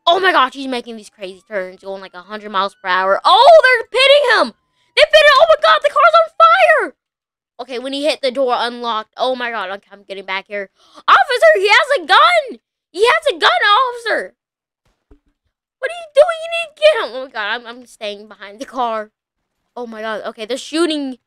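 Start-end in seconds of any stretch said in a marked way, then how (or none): 3.2-6.9 very high, loud excited yells; cheering the pit as it happens; excited shouting. Real, not acted. Police pit the van. 11.1-17.2 urgent shouting. Real, not acted. Warns officer about gun.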